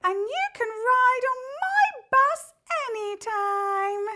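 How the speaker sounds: high, sweet people-pleasing voice; very high sugary welcoming voice; the pleaser. Acted. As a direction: gush like an eager pleaser. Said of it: "I am the pleaser".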